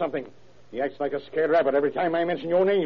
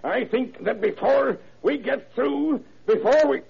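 collapsing; 9 semitones higher than usual. Acted. Collapsing mid-sentence from heart attack.

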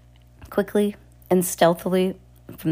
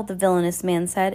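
ordinary delivery

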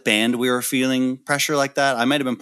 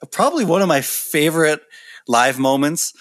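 ordinary talk

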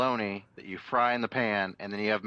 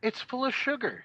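shocked; 11 semitones higher than usual. Retold or imitated. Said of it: "I'm like".

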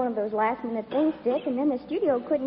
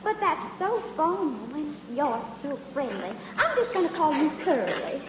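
Southern belle routine; 5 dB quieter than usual. Pretend. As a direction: apologise sweetly in a put-on Southern accent. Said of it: "my little old southern routine".

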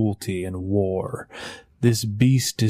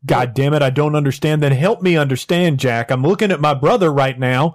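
loud, higher, angry demand; frustrated outburst; 4 semitones higher, 5 dB louder, faster than usual. Acted. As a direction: snap at him to explain. West furious at Jack's silence.

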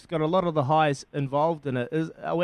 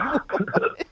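confused what; 10 semitones higher than usual. Retold or imitated. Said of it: "And I said".

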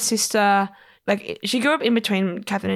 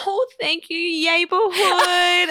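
high, slow sing-song thanks; mock of the host's bubbly greeting; imitating Sarah; 8 semitones higher, much slower than usual. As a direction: drag out a gushing thank you. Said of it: "Sarah going up to them being like".

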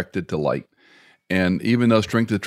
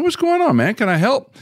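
much higher, eager and a bit louder; young pastor to upset couple, high and eager; eager helpful young pastor; 3 dB louder than usual. Retold or imitated. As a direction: reach out sounding keen to help. Voicing his young self reaching out.

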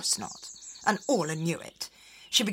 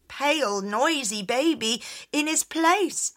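ordinary delivery, nothing marked

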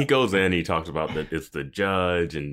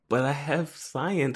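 whiny high; 9 semitones higher, slower than usual. Retold or imitated. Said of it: "they're like".

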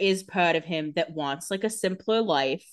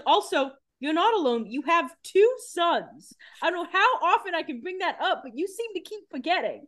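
much higher, sarcastic reminder; mock exasperation; 11 semitones higher than usual. Stefan reminding his mother she has sons, high and sarcastic. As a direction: needle her like an exasperated son. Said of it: "And he was like".

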